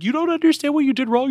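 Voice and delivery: wife scolding Bam in a hammed-up squeal; mocking the wife. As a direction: rattle it off in a shrill mock whine. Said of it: "she's like".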